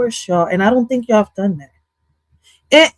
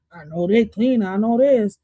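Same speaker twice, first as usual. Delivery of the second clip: ordinary talk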